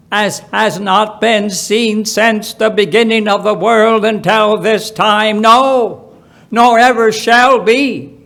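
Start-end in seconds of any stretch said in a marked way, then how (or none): none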